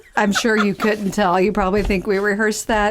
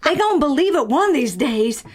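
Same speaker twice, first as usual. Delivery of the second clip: put-on Southern belle accent. Improvised witness who knew all along, Southern accent. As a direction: play a wistful Southern witness, hammed-up. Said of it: "our Southern Belle accents".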